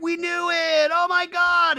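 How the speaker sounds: high, loud mock squeal; loud, overjoyed imitation; imitated freak-out. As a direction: squeal like an excited crowd. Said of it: "everybody's freaking out".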